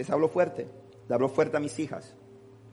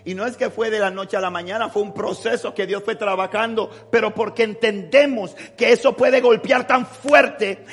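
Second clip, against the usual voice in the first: preaching at full force; 8 semitones higher, 10 dB louder than usual. Acted. Pastor preaching to the congregation at full force. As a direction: thunder it out like a revival preacher.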